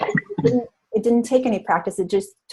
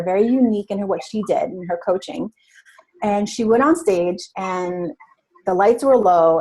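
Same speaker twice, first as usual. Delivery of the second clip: ordinary talk, nothing marked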